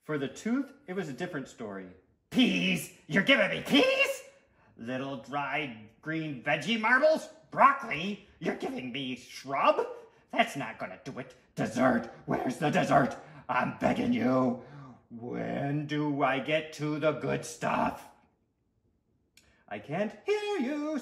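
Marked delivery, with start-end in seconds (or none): 2.3-18.1 indignant, pleading character voice; greedy little monster appalled by vegetables; outraged sweet tooth. Acted. Story figure: outraged tooth. 19.7-21.0 high, singsong refusal to listen; blocking it out. Acted. Boy blocking out tooth.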